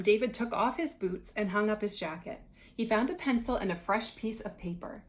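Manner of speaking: normal talking voice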